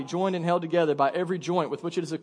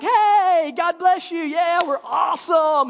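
high, loud, drawn-out gushing hello; fake happy greeting; 13 semitones higher, 9 dB louder, slower than usual. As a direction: overdo the cheery church greeting. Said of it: "they put on their happy face".

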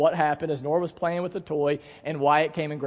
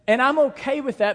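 normal talking voice

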